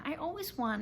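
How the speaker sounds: plain reading voice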